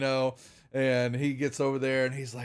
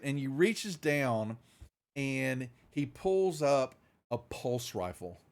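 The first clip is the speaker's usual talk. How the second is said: normal talking voice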